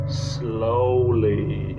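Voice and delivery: ordinary delivery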